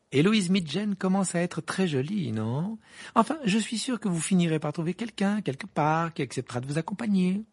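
high, condescending chatter; haughty. A narrator's reading. Hermione patronising the boys without partners, haughty. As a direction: console them with lofty disdain. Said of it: "d'un air hautain".

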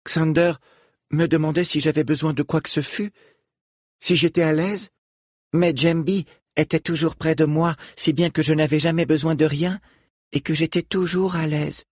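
a lightly voiced young girl. Falom speaks at length.

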